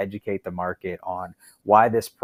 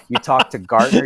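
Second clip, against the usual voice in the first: usual speaking voice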